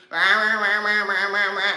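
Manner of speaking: dismissive mock yammering in a run of rahs; mocking chatter. Woman tired of her critics' rhetoric, mocking their yammering. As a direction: imitate critics droning on and on.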